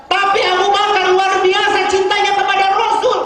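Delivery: high, loud preacher's cry of devotion; fervent preaching. Acted. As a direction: proclaim it to the hall with full force. Preacher raises voice again. (berkhotbah dengan suara tinggi dan lantang)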